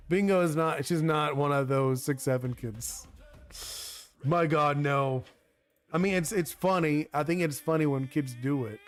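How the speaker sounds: ordinary talk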